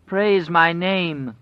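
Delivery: slow, high, loud whine; whining. A narrator's reading. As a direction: whine for praise. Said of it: "the wolf whined".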